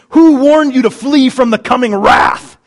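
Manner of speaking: loud, slow, accusing denunciation; fiery prophet's warning. Acted. John the Baptist challenging his hearers, loud fiery warning. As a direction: hurl the question out forcefully. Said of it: "that's how John delivered it".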